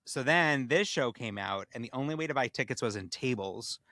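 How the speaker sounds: normal talking voice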